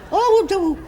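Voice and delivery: a sudden high, loud call out; loud cry from the audience. Retold or imitated. A laughing woman in the upper circle calls out loud. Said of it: "I suddenly heard".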